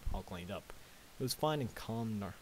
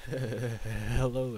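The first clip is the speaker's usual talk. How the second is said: stuttering; 3 semitones higher, 7 dB louder than usual. Acted. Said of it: "stuttered the earth pony".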